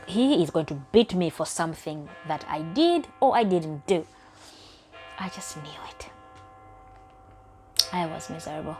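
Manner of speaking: ordinary talk, nothing marked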